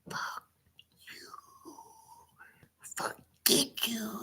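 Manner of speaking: whispered curse; whispered curse at his father; under the breath. Retold or imitated. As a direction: hiss it where dad can't hear. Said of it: "under my breath".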